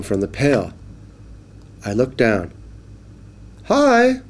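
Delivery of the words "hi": high cheery fish greeting; little fish. Acted. Little fish greeting the boy, high and cheerful. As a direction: chirp hello like a tiny fish. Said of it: "said the little fish".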